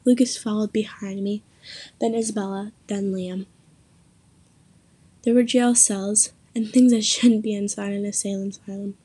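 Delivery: ordinary delivery, nothing marked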